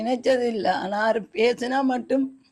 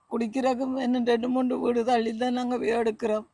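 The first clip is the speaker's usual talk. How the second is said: ordinary delivery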